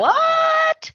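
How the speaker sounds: very high, drawn-out squeal of disbelief; hammed-up shocked wife; mock shock. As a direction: stretch it out sky-high, incredulous. Retold wife's shocked reaction.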